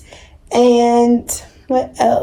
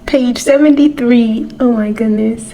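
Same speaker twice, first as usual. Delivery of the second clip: usual speaking voice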